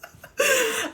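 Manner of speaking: short high giggle; high laugh. Real, not acted. Host laughing at her own sarcasm, giggle. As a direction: let a giggle escape.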